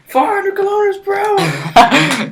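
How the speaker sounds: very high, loud, drawn-out comic yell; mock shout. Player joking about the train's speed, hammed-up high shout. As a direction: stretch it out in a squealing shout.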